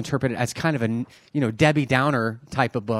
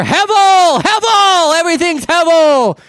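very high, very loud theatrical cry; stage proclamation; 10 dB louder, slower than usual. Acted. Preacher acting the teacher taking the stage, booming theatrical shout. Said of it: "the teacher could take the stage".

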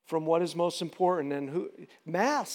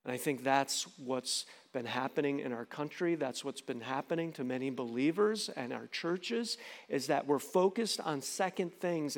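normal talking voice

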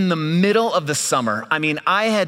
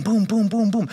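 rhythmic mock boom boom; counting boom; 6 semitones higher than usual. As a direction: tap out each boom like dropping coins. Counting out dimes onto table.

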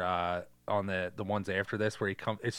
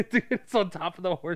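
ordinary talk

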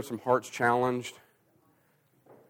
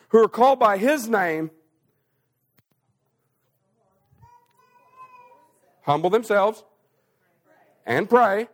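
high, loud revival preaching; full-force preaching; 8 semitones higher, 6 dB louder than usual. Acted.

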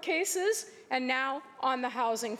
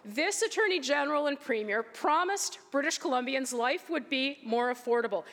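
ordinary talk

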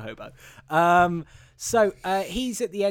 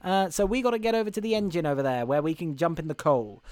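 put-on American accent, urgent and pitched up; Tom Hanks's hobo; 6 semitones higher than usual. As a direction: call out as a hammy Boston-New York drifter. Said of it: "'cause he's like".

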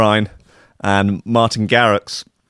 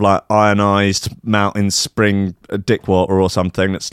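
usual speaking voice

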